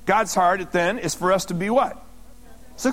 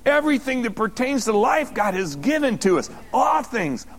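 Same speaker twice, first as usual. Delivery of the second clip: usual speaking voice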